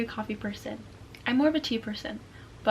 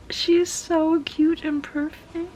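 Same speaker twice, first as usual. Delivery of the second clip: adoring coo; 5 semitones higher, 3 dB louder, slower than usual. Real, not acted. Owner admires the newly awake little one, cooing. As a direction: coo it slowly, melting. Gushing over the adorable pet.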